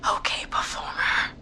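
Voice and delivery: slow unvoiced whisper. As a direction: whisper from a hiding spot. Whispered instructions while hiding.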